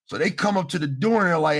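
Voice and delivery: ordinary delivery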